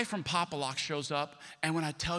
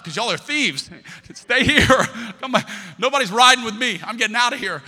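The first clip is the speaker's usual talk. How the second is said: loud laughing yell, much higher; laughing shout; 4 semitones higher, 10 dB louder than usual. Retold or imitated. Teasing shelter mates while escaping, loud laughing shout. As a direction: crack up while calling them thieves.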